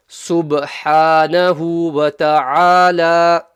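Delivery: SUBHANAHU WA TA'ALA slow, loud, bounced b; qalqala recitation. Shown as a demonstration. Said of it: "so you bounce it".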